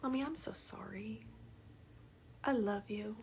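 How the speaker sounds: put-on a five-year-old's hushed apology to mommy; little girl's whispered apology. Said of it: "and then she goes".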